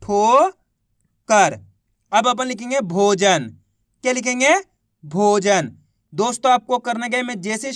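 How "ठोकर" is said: ठोकर stretched very slowly as one word; very slowly joining ठो and कर; drawn-out blend. Shown as a demonstration. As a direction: draw out ठोकर long and slow. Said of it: "तो मिलके बन गया".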